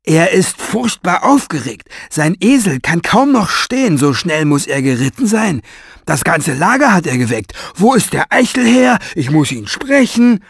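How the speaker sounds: high, loud urgent report; excited strong man. A narrator's reading. Strong man reporting Farid's arrival, high and urgent. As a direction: rush the news out breathlessly.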